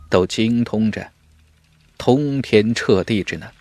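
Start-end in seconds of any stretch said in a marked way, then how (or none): none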